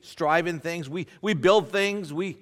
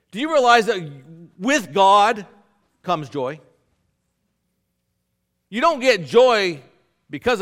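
louder, driving preacher's push; fervent preaching; 9 dB louder than usual. Real, not acted. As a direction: rouse the congregation. Preaching at full force.

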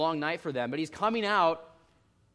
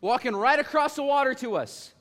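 higher and louder, panicky complaint; panicked disciples; 6 semitones higher, 5 dB louder than usual. Retold or imitated. Preacher voicing disciples who panic at a ghost, higher and louder. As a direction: play the disciples fearing they'll die. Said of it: "They're like".

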